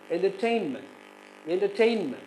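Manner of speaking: "entertainment", third syllable long and strong; stress on third syllable. Shown as a demonstration. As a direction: lift the TAIN in "entertainment". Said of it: "the third syllable is stressed".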